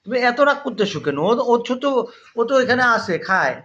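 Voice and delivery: high, loud, coaxing questions to a pet; pet-talk scolding. Real, not acted. Owner asks a jealous cat why it is angry, high scolding pet-talk.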